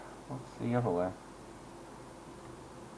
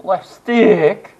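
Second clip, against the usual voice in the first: voice crack; 9 semitones higher, 17 dB louder than usual. Real, not acted. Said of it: "Sorry about that voice crack".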